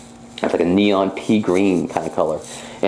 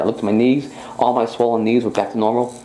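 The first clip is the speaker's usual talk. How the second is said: ordinary talk, nothing marked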